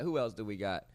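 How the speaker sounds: normal talking voice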